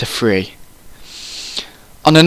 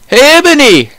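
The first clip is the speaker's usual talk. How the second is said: shouted call; 13 semitones higher, 7 dB louder than usual. A narrator's reading. A voice hailing Ebony outside Hogwarts, shouted. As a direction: call out the name loudly, stretched high. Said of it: "shouted a voice".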